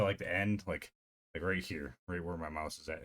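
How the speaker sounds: ordinary talk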